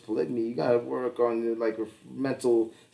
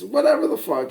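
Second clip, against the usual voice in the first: ordinary talk, nothing marked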